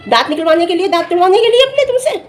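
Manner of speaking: rising, high-pitched rhetorical question; incredulous sarcasm. Real, not acted. Vlogger challenges dentist about male patients, incredulous. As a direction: push the question up high in disbelief.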